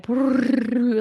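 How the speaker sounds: playful brrr of a fluttering heart; heart flutter sound. Retold or imitated. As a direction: make your heart buzz out loud. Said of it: "my heart just went like".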